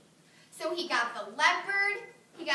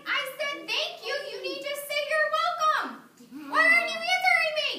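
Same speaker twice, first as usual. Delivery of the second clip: high, rising indignant demand; high, loud, getting faster and angrier; offended fairy; 11 semitones higher, 5 dB louder than usual. Acted. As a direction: huff like an insulted fairy. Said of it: "She was like".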